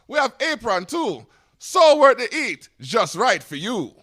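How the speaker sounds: high, hyped Caribbean radio ad voice. Acted. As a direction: sell the pepper sauce like a Caribbean radio spot. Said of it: "give you a commercial".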